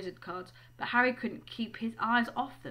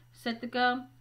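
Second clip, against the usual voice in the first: plain reading voice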